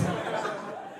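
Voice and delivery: real laugh. Real, not acted. Laughing at own story.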